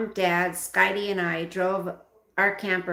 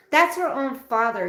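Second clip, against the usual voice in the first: indignant; 6 semitones higher, 5 dB louder than usual. Real, not acted. Reader's indignant aside.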